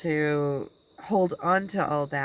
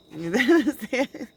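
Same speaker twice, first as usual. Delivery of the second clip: short laugh; 6 semitones higher, 4 dB louder than usual. Real, not acted. Laughs at own line.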